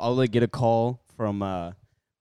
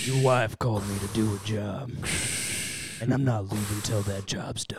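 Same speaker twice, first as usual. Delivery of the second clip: gruff movie hero; 2 semitones lower, 5 dB quieter than usual. Pretend. Mock movie dialogue.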